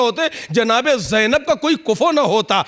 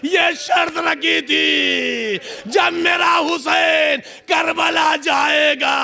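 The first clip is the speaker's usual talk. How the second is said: high, slow, shouted lament at full force; wailing sermon recitation; 8 semitones higher, slower than usual. Acted. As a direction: cry the tragedy out to the mourners. Impassioned masaib recitation, wailing. (ऊँची, धीमी, चीखती मर्सिया अदायगी)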